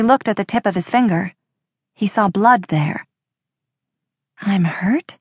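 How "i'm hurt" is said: puzzled, rising question; confused question. A narrator's reading. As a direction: ask it in mild bewilderment. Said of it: "his confusion quickly turned to fear".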